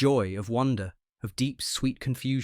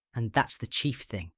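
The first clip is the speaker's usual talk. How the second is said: ordinary delivery, nothing marked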